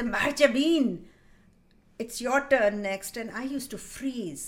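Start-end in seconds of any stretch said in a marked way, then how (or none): 0.0-3.2 menacing teacher. Acted. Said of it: "And he used to say".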